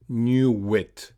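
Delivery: "Knew it" joined by a w; "Knew it" drawn out as one word; linked w glide. Shown as a demonstration. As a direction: model "Knew it" as knew-wit. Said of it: "connected with the off glide U".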